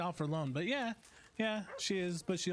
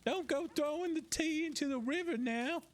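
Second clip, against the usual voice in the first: much higher mock British accent; hammed-up high British voice; British impression; 10 semitones higher than usual. Teasing Brits about tea in a high mock British accent. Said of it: "my British impression".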